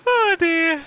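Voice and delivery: very high squeak through real giggles; helpless laughter. Real, not acted. Streamer unable to stop giggling at chat, laughing. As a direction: wheeze it out, weak from laughing. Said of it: "now you've got me laughing".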